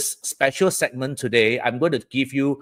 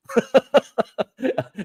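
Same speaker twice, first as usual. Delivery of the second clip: high-pitched giggle at own words; amused giggle; 11 semitones higher than usual. Real, not acted. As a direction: break into a high, quick laugh. Joking about own odd wording.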